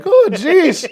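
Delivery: high, loud meek dismay; sheepish Gary. Pretend. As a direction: yelp like a kid caught out. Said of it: "And Gary's just like".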